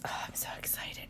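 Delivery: hushed excited whisper. Real, not acted. Telling viewers about her dinner in a whisper. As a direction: whisper it like a secret treat.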